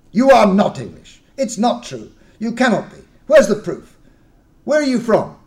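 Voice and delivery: loud, high furious accusation; angry disbelief. Acted. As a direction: tear into their story. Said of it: "dismissed with anger".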